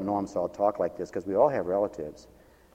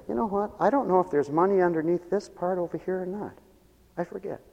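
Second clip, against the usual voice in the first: higher-pitched retold old man; lightly acted, higher quoted voice; 8 semitones higher than usual. Preacher retells the old man showing him hidden jars, higher voice.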